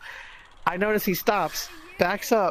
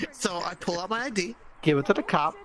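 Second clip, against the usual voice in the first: ordinary talk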